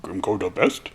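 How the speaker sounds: hint of a simple brute; dim orc. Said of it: "And Grimgor's like".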